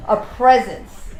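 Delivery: ordinary talk, nothing marked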